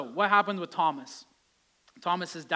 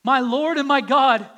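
higher, much louder cry of faith; exclaimed; 6 semitones higher, 13 dB louder than usual. Acted. Thomas's awed confession, loud and higher. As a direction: cry it out in awe. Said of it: "he exclaimed".

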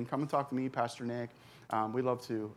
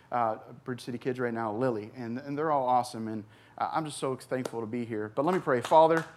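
ordinary talk

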